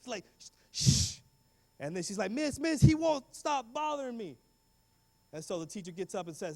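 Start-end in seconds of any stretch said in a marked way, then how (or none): 0.7-1.2 loud, long hissed shush. Retold or imitated. Girl shushing the boy tapping her shoulder, forceful. 2.3-4.4 high, drawn-out mock complaint; tattling schoolgirl. Girl telling teacher about the boy, high complaint.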